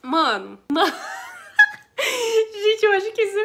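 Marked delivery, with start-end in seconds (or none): 1.0-2.5 high, loud burst of real laughter; laughter that almost tips into crying. Real, not acted. 2.5-3.4 words pushed out through real laughter; giggling through the words; laughing. Real, not acted. Still laughing after the story.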